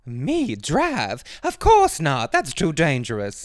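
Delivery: high, loud scandalized squeak on the refusal; prim mother. Acted. The mother refusing to drive, high and shocked. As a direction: gasp at the very idea, high.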